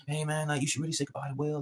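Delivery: hushed imitation of the uncle calming him; talking him down. Uncle coaxing Robin in the barn, soft and hushed. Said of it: "He's talking to him down".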